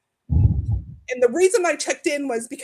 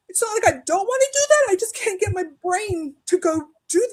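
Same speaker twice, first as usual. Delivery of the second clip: pleading; 6 semitones higher than usual. Retold or imitated. Pleading with the counselor.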